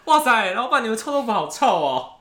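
mock outraged customer shouting 臭; hammed-up customer complaint. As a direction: overdo the disgusted customer for laughs. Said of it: "我是老板，我就说".

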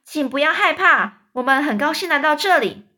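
loud friendly call. A narrator's reading. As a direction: call down kindly. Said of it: "詹姆斯继续喊着说".

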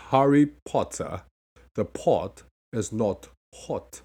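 slow British accent, pot and hot with a rounded o; crisp British accent, each t sounded. Shown as a demonstration. Teacher demonstrates the British accent, slowly. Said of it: "那如果念British accent的话，you gotta go".

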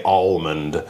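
ALMOND with the unwanted L pronounced; L sounded, wrongly. Shown as a demonstration. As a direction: say "almond" like "all-mund", as the mistake. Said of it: "That would be wrong".

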